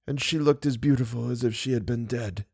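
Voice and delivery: a dying man lost in memory; weak, dying confession. Acted. Eric's dying confession continues.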